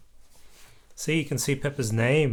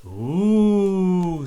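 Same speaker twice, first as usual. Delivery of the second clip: amazed ooh; 6 semitones higher, 6 dB louder than usual. A narrator's reading. Peppa amazed at the prize.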